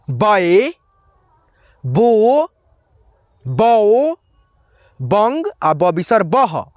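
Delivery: each syllable of नौ ना नी नू said slowly and separately with a pause after it; syllable drill. Shown as a demonstration. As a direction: recite the न barakhadi one syllable at a time, clearly. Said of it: "सारे बारह खड़ी को अब आपको यहाँ पे उच्चारण करना है". (हर अक्षर अलग और साफ़ बोलते हुए बारहखड़ी)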